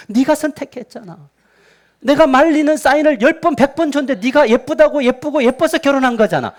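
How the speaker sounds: high, protesting comeback; flustered God. Acted. God answering a complaining spouse about their choice, flustered. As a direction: argue back in a hurry of disbelief, flustered. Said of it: "하나님이 당황하시면서".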